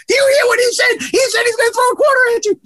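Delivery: very high, loud, shrill mimicry of the angry player; mock him in a high shrill squeal. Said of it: "high, shrill voice".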